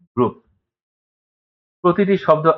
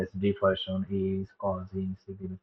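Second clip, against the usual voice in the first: slow, much softer sub-vocal reading; mouthing to oneself; 3 semitones lower, 10 dB quieter, much slower than usual. Shown as a demonstration. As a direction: mumble each word under your breath. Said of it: "অনেকে আবার মনে মনে বলি যে". (মনে মনে উচ্চারণ করে ধীরে পড়া)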